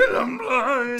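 laughing high wail; mock wail while laughing. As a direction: join in the hammy cry.

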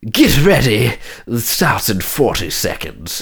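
put-on mid-Atlantic announcer accent. Announcing the round start in mid-Atlantic accent. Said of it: "Is she British?".

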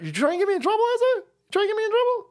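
playfully mock-offended at the chatter; mock accusation. Teasing a chatter who tries to get him in trouble, in a squeaky voice.

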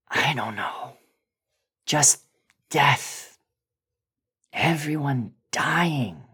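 high, slow, strained voice with whispered breaks; a shaken man reliving something he can't explain; haunted Bill. Acted.

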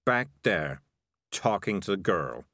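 mildly sulky, held-back reply. A narrator's reading. Morris answering where the kid is, mildly sulky. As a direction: answer with a touch of a sulk. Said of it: "said Morris sulkily".

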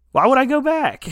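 mock outraged; incredulous protest. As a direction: throw it back at them. [name] refusing.

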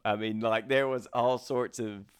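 usual speaking voice

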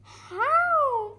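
put-on high-pitched baby-animal question; baby deer asking. A narrator's reading. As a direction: ask as a puzzled baby deer. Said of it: "asked Baby Deer".

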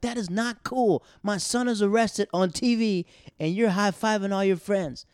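high, loud scolding mom; angry mom. Retold or imitated. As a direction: scold him like an outraged mother. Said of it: "His mom was like".

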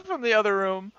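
normal talking voice

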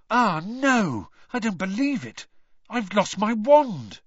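high, alarmed cry of dismay; Harry discovers his wand is gone, dismayed. Acted. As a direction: panic at the empty pocket. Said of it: "Harry finds wand missing".

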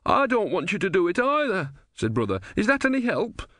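high, plaintive reasoning; helpless plea; pleading Brother. A narrator's reading. Brother pleading with sailors about to drown him.

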